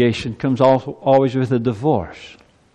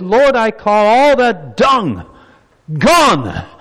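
rising, louder preacher's cry; preaching at full force; 7 semitones higher, 5 dB louder than usual. Acted. Preacher urging the congregation, rising to a cry. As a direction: build the sermon to a shout.

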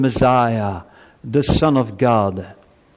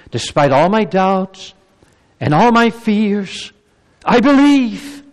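higher, louder, climbing cry; emphatic cry; 9 semitones higher, 6 dB louder than usual. Acted. Preacher voicing Martha's emphatic faith as a cry. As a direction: cry out the faith over the doubts. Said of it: "very emphatic".

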